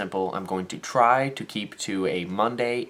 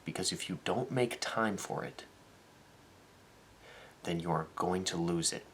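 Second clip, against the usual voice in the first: softer, earnest advice; sincere serious note; 7 dB quieter than usual. Real, not acted. To viewers about making time to dance, softly sincere. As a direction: speak it from the heart, quietly.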